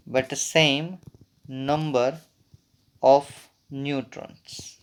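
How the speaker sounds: very slow, in small chunks; dictation pace. Real, not acted. Teacher dictating the isotones definition to students, slow dictation. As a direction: leave long gaps so they can write.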